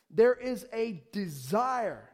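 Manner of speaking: ordinary delivery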